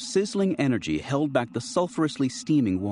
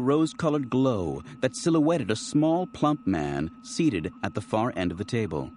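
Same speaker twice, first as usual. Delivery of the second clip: ordinary delivery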